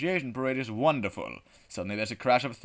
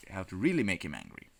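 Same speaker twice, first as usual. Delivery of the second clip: usual speaking voice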